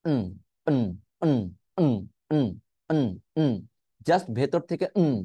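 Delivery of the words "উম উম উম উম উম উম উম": "উম" as a short hum from inside; isolated nasal grunts on "উম"; syllabic N demo. Shown as a demonstration. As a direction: hum "উম" with no vowel, each one separate. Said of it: "এটাকে উচ্চারণ করবে এরকম".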